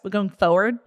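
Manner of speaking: Midwestern "forward", first r swallowed; Midwest accent. Shown as a demonstration. Host mocking the cheated version, Midwest accent. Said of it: "sounds like you're from the Midwest".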